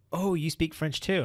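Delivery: delighted little exclamation; she's delighted he speaks French; excited. Retold or imitated. Girl's delighted reply retold.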